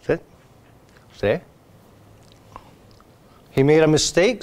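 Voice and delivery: usual speaking voice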